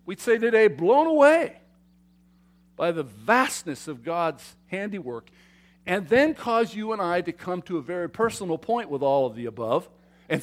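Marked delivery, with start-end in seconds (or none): none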